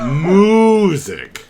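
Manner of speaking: Music as a loud, drawn-out "Moooo-sic"; echoed cow pun. Retold or imitated. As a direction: swoop through "Mu" of Music like a moo. Echoes the cow's moo pun.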